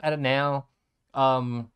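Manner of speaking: ordinary delivery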